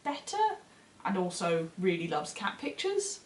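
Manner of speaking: ordinary talk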